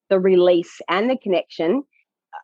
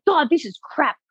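higher-pitched mock frustration; imitating frustrated man; 7 semitones higher than usual. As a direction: voice his fed-up complaint. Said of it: "He's getting so frustrated".

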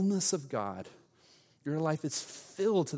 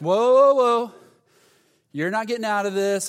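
loud halting protest; 8 semitones higher, 10 dB louder than usual. Retold or imitated. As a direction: imitate a group calling him back. Group stops him, acted.

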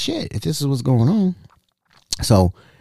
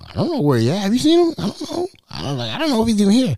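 nervous high denial; 8 semitones higher, faster than usual. Retold or imitated. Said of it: "Everybody like".

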